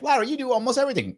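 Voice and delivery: high, fast, teasing viewer impression; mock viewer voice. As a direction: play the nagging fan, quick and high. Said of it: "I know you're like".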